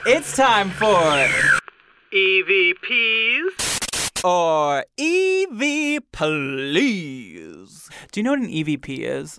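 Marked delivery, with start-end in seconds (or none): 0.1-7.9 slow, drawn-out spooky intro; spooky announcer. Pretend. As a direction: stretch it out like a haunted host. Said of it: "You wanna hear some ghost voices?".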